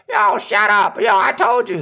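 high, loud mock yell at the homeboys; pretend shout. Calling out to friends at a fight party, loud mock yell. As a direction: bark it over the party noise.

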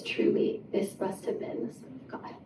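a hushed, whispered confession of awe; awed whisper. Acted.

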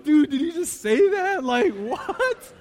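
disbelieving words through laughter; laughing. Retold or imitated. Onlookers cracking up at Boro's reply, laughing. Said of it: "everyone just cracking up like".

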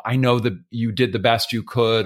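usual speaking voice

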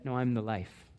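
ordinary talk, nothing marked

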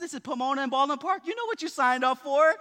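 quick, laughing comeback; laughing retort. Retold or imitated. Pastor retelling his joking reply to his wife, laughing. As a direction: tease her fast with a laugh in it. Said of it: "I said".

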